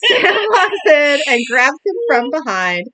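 high, loud, laughing narration. Real, not acted. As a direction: push the narration through giggles. Resuming narration through a laughing fit.

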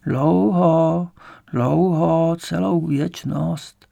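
put-on slow, drawn-out old woman; old woman's voice. A narrator's reading. Old woman with no sense of time answering, slow and drawn-out. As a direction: stretch the words as a weary grandmother.

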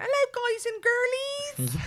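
high, slow, sugary vlogger greeting; Sophie Anderson impression. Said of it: "I could do an impression of her".

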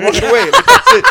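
high, loud laughing delivery; real laughter through the words. Real, not acted. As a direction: crack up telling the punchline. Laughing at own anecdote's punchline.

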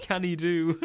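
put-on loud, high overdone Northern accent; exaggerated Northern twang. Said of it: "it's gone too northern".

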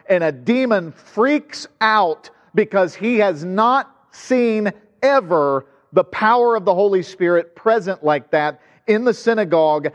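normal talking voice

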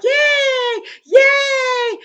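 very high, loud squealed mock yay; mock cheering. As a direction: squeal it like a giddy kid. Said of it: "Peter's gonna be like".

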